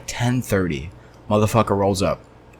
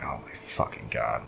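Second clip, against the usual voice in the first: slow, much softer and lower groan under the breath; exasperated mutter; 8 semitones lower, 14 dB quieter than usual. Retold or imitated. Cashier groans to himself when the customer demands the manager, slow and hushed. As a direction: sink into it like you can't believe this.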